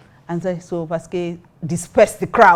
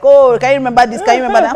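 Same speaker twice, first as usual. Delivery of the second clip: higher, louder gushing imitation; mock excitement; 6 semitones higher, 8 dB louder, faster than usual. Snobbish guest mocking others' reminiscing, mock excitement. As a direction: gush it in mockery of the group.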